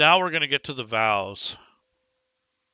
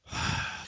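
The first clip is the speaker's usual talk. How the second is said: quiet weary sigh; reluctant sigh; soft sigh; 7 dB quieter than usual. Acted. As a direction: breathe out before speaking. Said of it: "kinda sighs".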